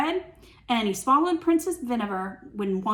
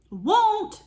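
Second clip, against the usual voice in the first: high, loud bratty "won't"; hammed-up stubborn dragon; defiant refusal; 10 semitones higher, 4 dB louder than usual.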